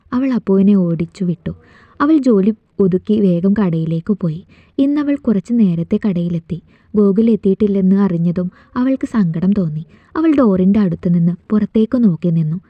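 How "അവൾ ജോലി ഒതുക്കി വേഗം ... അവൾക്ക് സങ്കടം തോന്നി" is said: usual speaking voice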